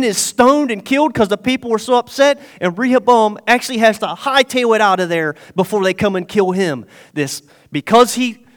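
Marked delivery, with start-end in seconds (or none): none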